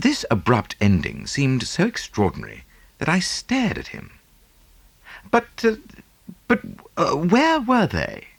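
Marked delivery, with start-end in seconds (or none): 5.3-8.3 higher, stammering in disbelief; bewildered question. Acted. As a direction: stumble over it, truly lost. Baffled by abrupt story ending.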